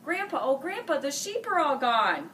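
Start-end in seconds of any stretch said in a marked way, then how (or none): none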